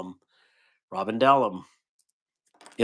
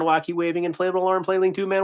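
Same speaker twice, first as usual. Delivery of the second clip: Wacky waving inflatable arm flailing tube man rattled off fast and higher; faster; 6 semitones higher, faster than usual. Shown as a demonstration. Attempting the speed challenge from chat, rushed and higher. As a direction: race through it at speed. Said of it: "Say that as fast as you can three times".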